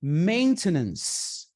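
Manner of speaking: maintenance, drawn out and clear; slow model word. Shown as a demonstration. Teacher drilling the word slowly for students. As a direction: stretch every syllable of maintenance. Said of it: "Olha como termina a palavra".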